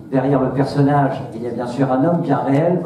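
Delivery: usual speaking voice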